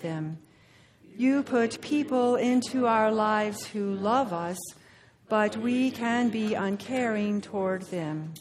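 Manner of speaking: slow, measured prayer voice. Acted. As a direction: pray it slowly and reverently. Leads a prayer.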